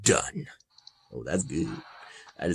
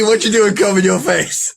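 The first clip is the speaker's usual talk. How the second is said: ordinary talk, nothing marked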